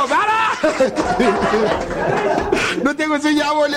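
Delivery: high sharp slurred stop; drunk old man. Acted. Drunk father silencing his son, high and sharp. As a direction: cut him off like a sloshed dad. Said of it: "Y el viejo".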